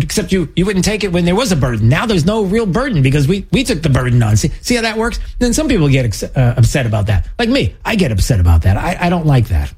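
ordinary talk

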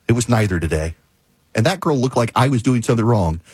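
normal talking voice

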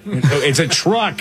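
high, drawn-out parental correction; mock exasperated parent. Parent correcting a kid who says lorry, mock exasperated. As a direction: stretch "truck" in playful protest.

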